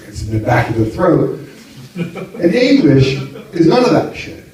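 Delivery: usual speaking voice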